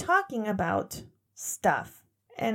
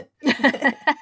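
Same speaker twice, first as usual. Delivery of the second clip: burst of laughter; 4 semitones higher, 9 dB louder than usual. Real, not acted. Laughing at own story.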